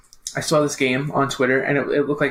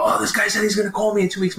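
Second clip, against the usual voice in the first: excited; 7 semitones higher than usual. Retold or imitated. Himself quoted, excited.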